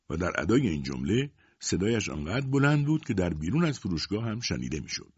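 usual speaking voice

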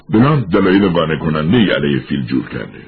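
plain reading voice

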